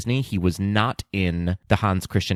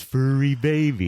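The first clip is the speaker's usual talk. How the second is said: slow, woozy higher robot voice; drunk robot voice; 5 semitones higher, slower than usual. Retold or imitated. Repeats Baymax line, voiced.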